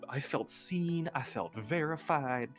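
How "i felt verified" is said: usual speaking voice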